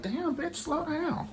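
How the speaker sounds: breathless falsetto pleading; imitating the struggling violinist; mock violinist. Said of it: "She was like".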